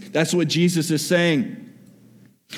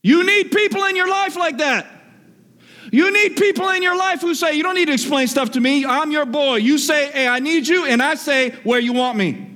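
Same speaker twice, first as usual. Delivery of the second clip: preaching at full force; 9 semitones higher than usual. Acted. Preacher urging the church to find loyal friends, fervent. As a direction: preach it out with fire.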